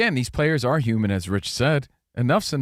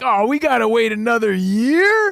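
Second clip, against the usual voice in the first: higher and louder, exaggerated disbelief; mock impatient whine; 10 semitones higher, 5 dB louder than usual. Cohost imitating fans waiting a year for the sequel, exaggerated whine. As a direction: whine it like a fan who can't wait. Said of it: "which at the time felt like".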